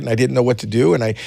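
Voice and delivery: ordinary talk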